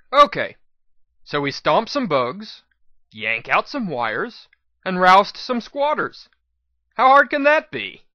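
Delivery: breezy, overconfident, rising at the end; cocky grin. A narrator's reading. Hero grins at her friends, breezy and overconfident. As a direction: shrug off the danger cheerfully. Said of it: "I said with a grin".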